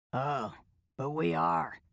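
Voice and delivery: lightly voiced old drifter; sly insistence in an old man's rasp; raspy old man.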